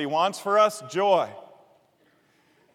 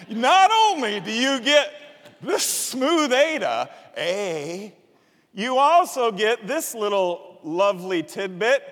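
infomercial pitch; 3 semitones higher, 4 dB louder than usual. Pretend. Pastor joking like he sells Ginsu knives, hammed-up infomercial pitch. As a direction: sell it like a late-night TV ad. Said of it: "selling Ginsu knives".

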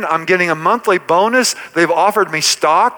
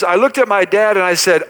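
ordinary talk

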